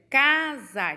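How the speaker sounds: "casar" dito em duas sílabas, ca-sar; separação silábica. Shown as a demonstration. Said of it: "Duas sílabas". ("casar" escandido, ca... sar)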